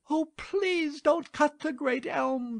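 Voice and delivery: very high, imploring maiden; begging. A narrator's reading. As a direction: beg desperately in a high voice. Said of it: "begged the good maiden".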